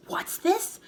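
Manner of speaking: a shocked little gasp. Acted. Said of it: "gasped Mister Twit".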